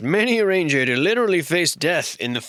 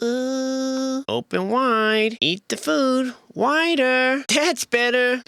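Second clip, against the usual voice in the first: a much higher put-on voice, slow on "open wide"; coaxing feeder; 10 semitones higher, much slower than usual. Feeding the drawn creature, high coaxing nanny voice. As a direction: coax a reluctant eater like a fussy nanny. Voicing someone feeding a drawing.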